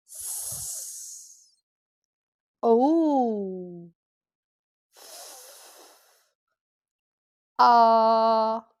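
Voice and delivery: S and F as long bare hisses, O and A held; sound by sound. Shown as a demonstration. Teacher counting sounds with pupils, sound by sound. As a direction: stretch S, O, F, A one by one. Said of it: "pour les sons, préparez vos doigts".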